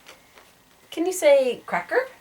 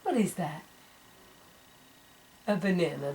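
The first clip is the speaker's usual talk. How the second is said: normal talking voice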